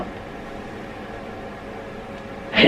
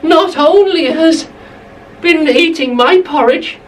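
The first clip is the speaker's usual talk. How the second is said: high, outraged little bear; Baby Bear voice; 8 semitones higher than usual. Acted. Narrator to children, Baby Bear finds his bowl empty, high and upset. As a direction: squeak it out high like a small upset cub. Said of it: "he said".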